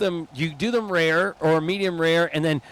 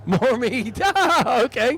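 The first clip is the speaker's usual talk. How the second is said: laughing; 6 semitones higher, 4 dB louder, much slower than usual. Real, not acted. Amused echo.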